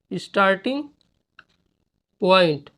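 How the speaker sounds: Starting and point split by a pause. Shown as a demonstration. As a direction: dictate starting point word by word. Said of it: "dictating word by word".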